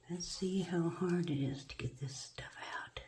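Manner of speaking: soft whispered aside to self. Real, not acted. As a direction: whisper it while concentrating. Unvoiced aside while working.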